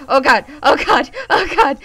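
loud, rising panicked yelps; panicked cry. Real, not acted. Player fleeing a creature they pissed off, panicked. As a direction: panic mounting with each cry.

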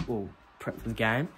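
ordinary talk

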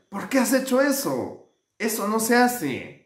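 lightly indignant telling-off; mild scolding. A narrator's reading. Friends reproaching the lying shepherd, mildly scolding.

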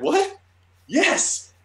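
very high-pitched elated squeal; thrilled disbelief. Retold or imitated. Fan asked for his idol's number, thrilled disbelief. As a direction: react with giddy triumph, acting it out.

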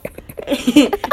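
loud, high burst of laughter; big laugh. Real, not acted. Telling a friend the bus story, laughing out loud. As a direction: crack up at the punchline.

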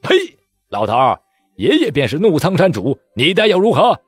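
loud, rising arrogant taunt; spitting scorn. Acted. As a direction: spit and brag. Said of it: "那军官呸了一声".

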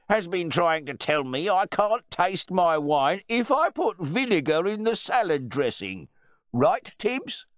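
loud, high-pitched public ridicule; jeering, pompous bluster; mocking host. A narrator's reading. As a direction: make the butler look foolish before guests. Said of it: "the host began to mock his butler".

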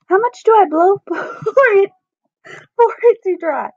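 giggling through the line; real laughter breaking into the words; slowed by giggles; laughing. Real, not acted. Giggles mid-line, breaking character.

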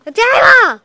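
sudden loud yell; shout. Real, not acted. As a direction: yell the name out. Kid yelling at sibling.

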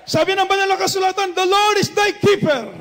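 loud, high proclaiming of the verse; preaching at full force. Acted. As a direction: proclaim it to the whole church.

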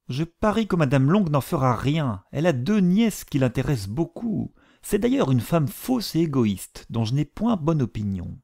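high, shrill-pitched gossiping mother; fretful mother. A narrator's reading. The mother scorns Mrs Long, high and fretful. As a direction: complain about a rival, voice lifted high.

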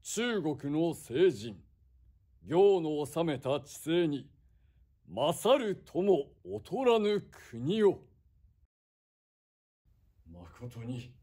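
loud, slow stage declamation as a samurai lord; theatrical feudal lord. Acted. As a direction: proclaim it like a warlord on stage. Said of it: "再現お芝居でござった".

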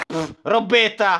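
normal talking voice

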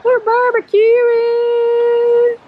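excited announcement. Real, not acted. Announcing to camera, drawn out.

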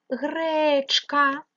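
Гречка with a long, high, stressed е; stressed check word. Shown as a demonstration. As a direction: stretch the first vowel of Гречка. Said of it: "перевіряємо".

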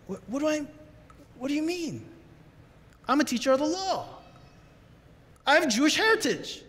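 puzzled Nicodemus. Acted. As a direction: play a confused scholar asking back. Nicodemus voiced, confused reply.

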